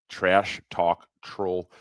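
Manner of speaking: "Trash talk troll" spelled out slowly, word by word; slow, deliberate reread after a stumble; slow careful read. Real, not acted.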